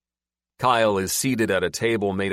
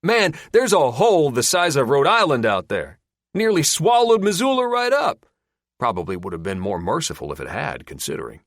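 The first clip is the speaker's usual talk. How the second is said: higher, louder, amazed; excited backwoods storyteller; 7 semitones higher, 6 dB louder than usual. Acted. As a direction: spin the tale with relish. Gil telling of the hole.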